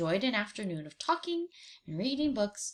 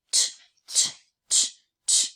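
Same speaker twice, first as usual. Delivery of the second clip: sharp unvoiced tongue clicks; clicking tch sounds. Shown as a demonstration. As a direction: click the tongue like rattling bars. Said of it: "What does he say?".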